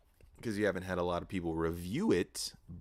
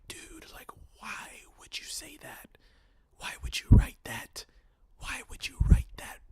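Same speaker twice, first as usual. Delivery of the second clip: exasperated whisper. Acted. Viewer asking the screenwriter why he wrote that, exasperated whisper.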